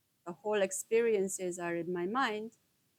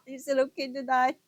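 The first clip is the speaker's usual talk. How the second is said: laughing, higher and louder, quick; 7 semitones higher, 7 dB louder, faster than usual. Real, not acted. Adult laughing at her teenage question.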